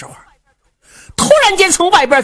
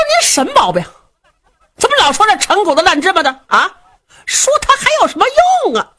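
fast, much higher, exasperated; irritated son; 10 semitones higher, 3 dB louder, faster than usual. Acted. Son snapping at his father to stop dwelling on the past, exasperated. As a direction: snap at the nagging old man.

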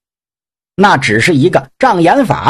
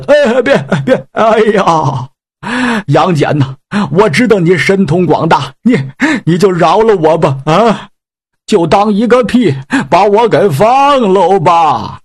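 grovel and beg to be let go; begging pig demon; 2 semitones higher than usual. Acted. Pig demon begs Yang Jian to spare him, whining.

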